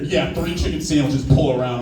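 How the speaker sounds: imitated cashier dropping the chirpy act; voice suddenly changed. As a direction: repeat the order plainly, unimpressed cashier. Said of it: "her voice changed real quick".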